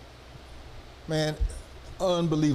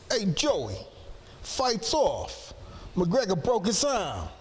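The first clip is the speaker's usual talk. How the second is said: put-on higher, slower Boston accent; 7 semitones higher, 3 dB quieter, much slower than usual. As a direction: call it out to Joey in a thick Boston accent. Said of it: "we're saying stuff in their Boston accent".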